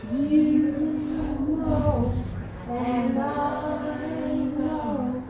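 slow, held, sing-song question; eerie creature voice. Acted. Critter in the cabin asking for its tail, eerie chant. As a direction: draw out each word, building suspense. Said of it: "and the voice said".

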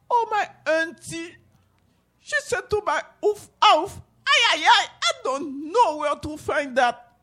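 put-on high, plaintive child's voice; small boy's voice. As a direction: answer like a sad little boy. Boy answers old lady.

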